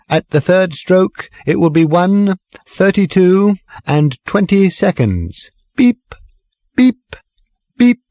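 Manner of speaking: high, stilted sing-song recorded voice; speaking clock. Acted. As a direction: sing the time like an old talking clock. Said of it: "odd, rather stilted sing-song voice".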